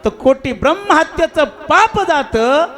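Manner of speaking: high, loud, insistent arguing; heated argument with God. Acted. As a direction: demand an answer, pressing hard. (तावातावाने उंच आवाजात वाद)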